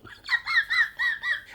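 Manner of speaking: breaks into a high, squealing laugh. Real, not acted. Laughing at his own demon joke, high squeal. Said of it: "Anyway, off they went".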